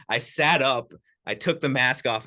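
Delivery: usual speaking voice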